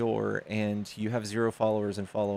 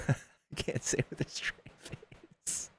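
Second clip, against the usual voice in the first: whispered giggle; 8 dB quieter than usual. Real, not acted.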